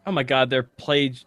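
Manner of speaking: ordinary delivery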